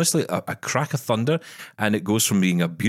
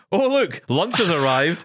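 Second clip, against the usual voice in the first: high, playful tiger spotting lunch; imagined tiger; 9 semitones higher, slower than usual. Acted. Man imagining a safari tiger spotting him, playful tiger voice. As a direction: put on a higher voice for a delighted predator. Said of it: "gigantic tiger saying".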